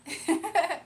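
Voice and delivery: short high laugh; a quick amused laugh; burst of laughter. Real, not acted. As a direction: laugh it off brightly. Laughs at her own Baby Yoda joke.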